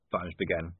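ordinary delivery

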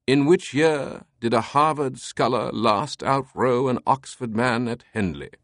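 put-on slow, solemn British accent; mock-solemn British examiner. A narrator's reading. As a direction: pose it gravely in a British voice. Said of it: "spoke in a solemn tone".

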